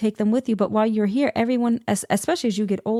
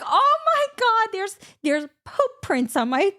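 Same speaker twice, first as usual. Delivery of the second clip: high, slow wail of dismay; hammed-up horrified squeal; highly upset, retold; 11 semitones higher than usual. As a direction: stammer it out in mock despair. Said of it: "I was highly upset".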